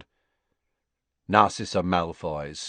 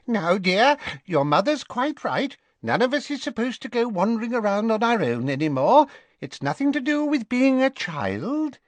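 much higher, fussing shopkeeper voice; a flustered old seamstress soothing a boy; fussy Madame Malkin. Acted. Madame Malkin soothing Draco, fussy and much higher. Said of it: "a voice Harry recognized as that of Madame Malkin said".

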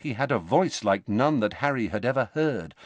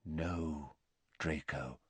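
much softer, calm and low; quietly; 5 semitones lower, 15 dB quieter, slower than usual. A narrator's reading. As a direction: hush it, steady and gentle. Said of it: "said Dumbledore quietly".

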